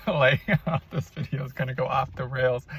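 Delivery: laughing through the words; real laugh as he talks; can't keep a straight face. Real, not acted. Laughing at his own rambling.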